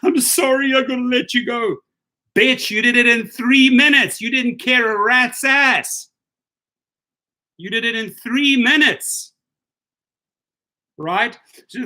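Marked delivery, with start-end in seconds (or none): 0.0-1.8 high, whiny mock-sobbing executive; higher mocking sob voice; mock fake tears. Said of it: "putting on the fake tears". 2.3-9.3 angry retort. Real, not acted. Angry rant at the executive.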